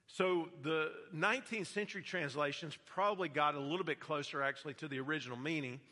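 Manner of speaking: usual speaking voice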